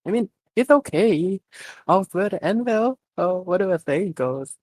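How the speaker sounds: put-on a girl covering her embarrassment, eyes darting; flustered girl. A narrator's reading. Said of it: "eye darting".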